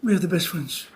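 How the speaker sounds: low, soft and intimate; tender wife. Acted. Wife to husband as best friend, tender. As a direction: quietly affirm it, voice dropped. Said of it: "And the wife said".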